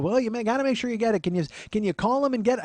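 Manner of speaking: high, loud imitated aunt; nagging aunt. Aunt checking on nephew's data entry, nagging voice.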